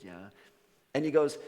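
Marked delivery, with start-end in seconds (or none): 0.0-0.3 small, soft, low reply; meek, mumbled admission; sheepish. Acted. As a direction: answer like a shamefaced wolf. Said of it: "gets all sheepish and goes".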